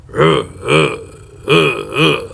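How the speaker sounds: short repeated grunts, mimicking a character; imitated grunting. Reviewer mimicking the bald guy with the eye patch, grunting. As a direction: grunt in separate bursts, imitating him. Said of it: "one has a patch over his eye and goes".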